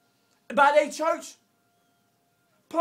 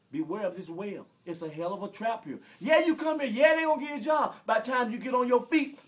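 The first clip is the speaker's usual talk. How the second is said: usual speaking voice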